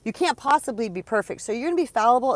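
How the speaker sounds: high, loud mocking voice; exaggerated mocking God voice; mock judgmental God. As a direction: ham up a scolding deity. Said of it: "God ... says".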